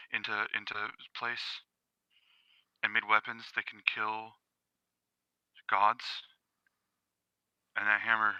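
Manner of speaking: hushed and deliberate; quiet menace. Acted. Smith warning a dog about his god-killing weapons, quietly menacing. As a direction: speak very softly, pausing between phrases. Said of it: "he says it real quiet".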